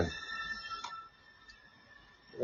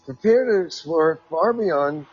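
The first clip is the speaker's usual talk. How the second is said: article read-aloud; 5 semitones higher than usual. Acted. Host reads a quote about Mars to listeners, raised reading voice.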